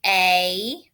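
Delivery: A said as its name, long "ay". Shown as a demonstration. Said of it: "How is the A gonna say its name".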